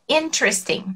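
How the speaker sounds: interesting as IN-tres-ting; interesting in three syllables. Shown as a demonstration. Teacher models interesting with three syllables. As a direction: skip the E after the first T in interesting. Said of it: "Vai do T pro R".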